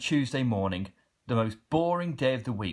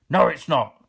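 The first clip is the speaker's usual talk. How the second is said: loud, grumpy snap back at the postman; troll grumpily contradicting the cheerful postman; grumpy troll retort; 2 semitones higher, 9 dB louder than usual. Acted. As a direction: bite back crossly and loud. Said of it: "grumped the Grunt".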